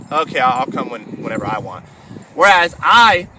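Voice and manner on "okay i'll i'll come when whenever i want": lower, fading lightly voiced dog; a hint of a stubborn dog shrugging; mock dog voice, lower and trailing off; voicing a lazy dog. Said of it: "they let their dog decide".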